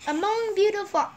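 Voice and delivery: usual speaking voice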